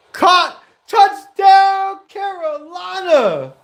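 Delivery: huge high, loud, drawn-out shout; touchdown call. Real, not acted. Calling a Carolina touchdown live, ecstatic shout. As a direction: explode with the big scoring call, stretching the team name.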